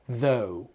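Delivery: THOUGH low and soft, voiced th into oh; th plus O. Shown as a demonstration. Teacher assembling the sounds for pupils, modelled THOUGH. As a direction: join the th to a gliding oh in "Though". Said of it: "vous allez faire le son O".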